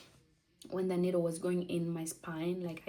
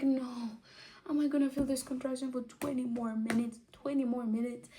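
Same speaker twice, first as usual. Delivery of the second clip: higher dismayed disbelief; 5 semitones higher than usual. Retold or imitated. Told to wait twenty minutes, dismayed disbelief.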